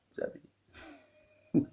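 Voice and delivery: slow breathy whisper, low and soft; slow confiding whisper; whispered aside. Real, not acted. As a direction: lean in and breathe it to them. Aside to the congregation.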